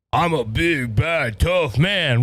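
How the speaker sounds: slow, drawn-out macho boast; mock tough guy. Host mocking men who beat up a woman, mock tough guy.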